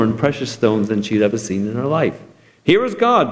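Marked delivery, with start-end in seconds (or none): 2.6-3.3 high, proud announcement; declaring treasurer. A narrator's reading. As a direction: present it grandly. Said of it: "declared the treasurer".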